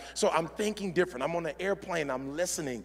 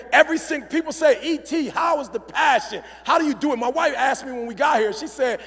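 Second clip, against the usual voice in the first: high, loud, driving sermon voice; full-force preaching; 10 semitones higher, 7 dB louder than usual. Acted. Motivational speaker closing his talk, preaching at full force. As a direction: keep the crowd fired up.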